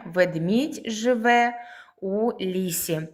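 slow, separated words for pupils; teacher spacing out each word. Shown as a demonstration. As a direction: dictate it slowly, a pause between words. Splitting sentence into words for pupils.

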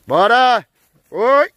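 high, loud herding yells; loud calls to push the herd along; cattle calls. Real, not acted. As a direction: holler to drive the cows on. Herding cows into the corral.

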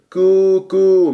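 light, held u on كو; light kāf. Shown as a demonstration. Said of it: "مرققة".

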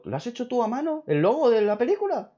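high, disbelieving questions; wide-eyed incredulous retelling; amazed question. Retold or imitated. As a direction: replay your astonishment, pitch way up. Acting out amazed questioning of giver.

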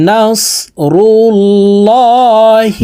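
الله chanted with a heavy, dark "law" sound; thick lam. Shown as a demonstration. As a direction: make the L of الله full and round, held long. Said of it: "এই লামকে ল বলে".

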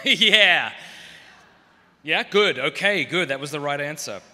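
loud, bluffing yeahs; fake confident agreement. Comedian bluffing agreement with the Irish priest. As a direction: pretend you understood every word. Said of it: "I just went".

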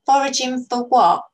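put-on girl questioning her friend's story, sceptical. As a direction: doubt every word of it. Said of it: "in the sceptical way".

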